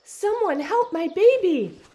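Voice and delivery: high, loud pleading wail; mother's desperate high cry; frantic cry. A narrator's reading. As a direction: beg in terror for your child. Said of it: "his mother cried".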